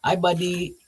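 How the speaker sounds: light mock of the pool guy greeting the dog; imitating the pool guy. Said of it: "He used to call Diamond his buddy".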